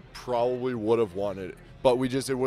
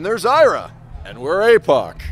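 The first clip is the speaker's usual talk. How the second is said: mock commercial pitch; 6 semitones higher, 11 dB louder than usual. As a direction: introduce yourself like a cheesy local ad. Mock commercial seeking a guitarist.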